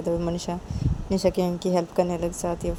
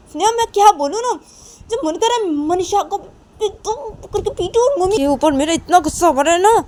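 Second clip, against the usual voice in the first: high-pitched angry shouting; furious outburst; 10 semitones higher, 8 dB louder than usual. Acted. Nisha rages about Manisha and mother, furious shouting. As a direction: let the rage burst out, loud and high. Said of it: "ऐसे गुस्सा करने लगती है".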